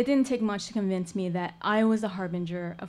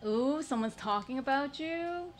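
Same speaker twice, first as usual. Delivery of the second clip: bright teasing lilt, a touch higher; giddy; 5 semitones higher than usual. Retold or imitated. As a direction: tease him playfully about gossip. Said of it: "she said giddily".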